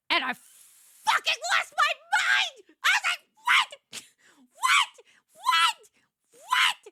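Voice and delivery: very high, loud shrieks; shocked screaming. Real, not acted. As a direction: scream it in disbelief. Retelling shocked reaction.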